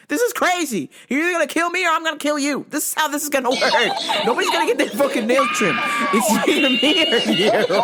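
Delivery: fast, high rant breaking into laughter; hysterical threats. Retold or imitated. Owner threatening cat during trim, hysterical. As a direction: rush threats, rising to a laughing shout.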